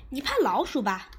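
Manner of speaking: 结结巴巴，尖高着急; 急得说不利索. Acted. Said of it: "布鲁斯急了". (着急地结巴抢白)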